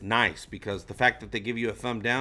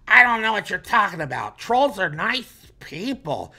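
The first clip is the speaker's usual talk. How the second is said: loud, high troll voice protesting; offended troll; 9 semitones higher, 7 dB louder than usual. Acted. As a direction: play an indignant troll, much louder and higher. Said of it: "People can be trolls".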